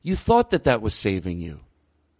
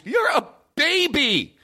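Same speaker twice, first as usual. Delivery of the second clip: much higher, slow, mocking "You're a baby."; 14 semitones higher, slower than usual. Shown as a demonstration. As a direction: talk down like to a baby, pitched way up. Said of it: "Sure, my voice".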